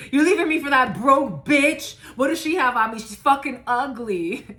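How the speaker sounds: mock jealous rant. Reader voicing a jealous ex, mock outraged yell. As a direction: whine and yell like a jilted ex.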